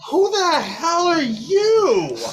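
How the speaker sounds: high, slow, loud challenge; who the hell, drawn out and fierce; demanding chiefess. Acted. Chiefess challenges the strangers on her boat, high and slow.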